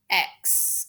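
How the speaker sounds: normal talking voice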